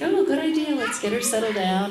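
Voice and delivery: sugary agreement; mock sweet doula voice; chirpy doula. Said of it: "the doula's like".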